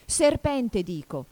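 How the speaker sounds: high loud start falling to a subdued finish; insist, then let it drop. A narrator's reading. Pigeon repeats the accusation, insisting then subdued. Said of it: "ma con tono più dimesso".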